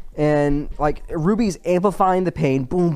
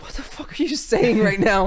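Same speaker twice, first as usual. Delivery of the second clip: pitched way up in disbelief; a stunned, confused high exclamation; high incredulous what-the-fuck; baffled outburst; 7 semitones higher than usual. Retold or imitated. Devin baffled mid-fight.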